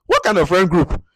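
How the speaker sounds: loud incredulous repeat of the question; exasperated exclamation. Retold or imitated. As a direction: throw up your hands at it. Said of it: "I'm like".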